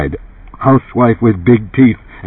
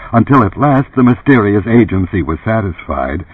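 ordinary delivery

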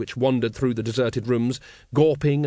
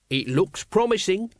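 critical appraisal; 6 semitones higher than usual. A narrator's reading. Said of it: "he said critically".

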